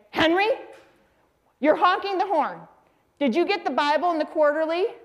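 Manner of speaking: high-pitched imitation of an irked wife; scolding mother. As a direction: scold your husband from the screen door. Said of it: "And she'd say".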